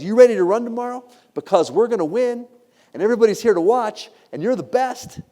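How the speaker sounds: rousing, eager rally; excited pep talk. Retold or imitated. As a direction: pump them up before the big race. Owner hyping her racing dog.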